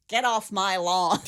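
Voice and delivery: put-on cranky old man yelling at kids; grouchy homeowner shooing kids away; grumpy old man. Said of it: "He's that guy".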